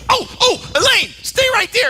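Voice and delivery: crazy guy bursting in, very high-pitched; Kramer. Retold or imitated. Crazy friend running in with a gift, frantically excited. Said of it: "Kramer's this crazy guy".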